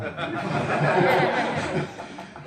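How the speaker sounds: high, loud burst of laughter. Real, not acted. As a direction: crack up. Laughing at own loophole.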